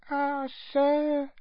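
high, regretful little squeak; doubtful squeaky elf. A narrator's reading. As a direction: sigh it in a tiny elf voice. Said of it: "said Winky, shaking her head".

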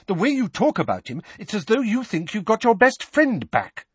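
high, heated accusation; accuse, voice climbing; agitated motherly reproach; accusing. A narrator's reading.

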